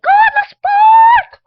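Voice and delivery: very high, drawn-out yell; battle shout. Retold or imitated. Child playing Eleven attacking Vecna, high mock battle scream. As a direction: scream a slow command at the monster.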